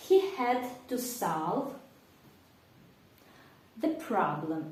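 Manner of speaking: slow and careful, for repeating aloud; slow repeat-after-me. Shown as a demonstration. Teacher models the sentence for the class to repeat. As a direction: say it slowly so students can repeat. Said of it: "Давайте повторимо вголос".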